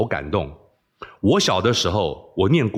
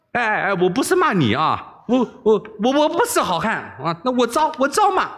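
pleading backpedal; 10 semitones higher than usual. Acted. Backs down, pleading.

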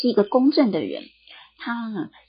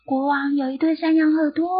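higher and a little louder; a bit louder; 7 semitones higher than usual. Acted. Barber telling the hole, a bit louder. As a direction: say it up a notch. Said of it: "说得大声了一点点".

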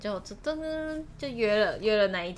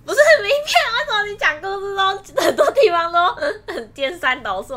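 loud, high, laughing objection; laughing protest; 11 semitones higher, 11 dB louder than usual. Real, not acted. Correcting her friend's mixed-up story, laughing loudly. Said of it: "我觉得很好笑".

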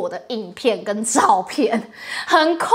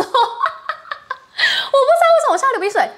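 high, slow words broken by real laughter; laughing while talking; 14 semitones higher, 4 dB louder, slower than usual. Real, not acted. Host laughing to her viewers, giggling. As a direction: giggle through the line about a runny nose.

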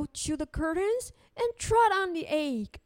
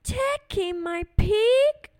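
surprised, louder and drawn out; puzzled old lady; 4 semitones higher, 6 dB louder, slower than usual. Acted. Old lady puzzled by the wise man's advice. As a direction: she can't believe the plan. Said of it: "said a wise old man".

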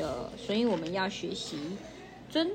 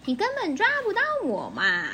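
teasing mosquito taunt; 8 semitones higher than usual. Acted.

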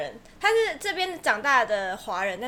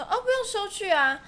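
casual shopkeeper; 7 semitones higher than usual. Retold or imitated. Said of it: "然后他就说".

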